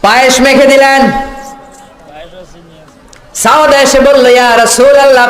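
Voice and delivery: high, loud sermon warning; fiery preaching cry; thundering preacher. Acted. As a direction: boom the warning out. Preaching at full force.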